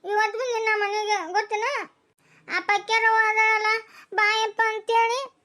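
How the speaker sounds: put-on crying child. Son tells father nobody fed them, crying. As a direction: sob through every line.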